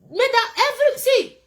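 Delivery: high, loud sudden burst; emphatic outburst. Real, not acted. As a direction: jump up with force.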